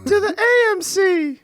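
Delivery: high, slow granny voice with a laugh; drawn-out old lady voice; old person voice. Acted. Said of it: "That's my old person voice".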